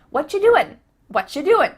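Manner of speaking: lively reduced question; casual whatcha doin. Shown as a demonstration. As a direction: toss out "What you doing" as "whatcha doin". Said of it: "I end that word with an N".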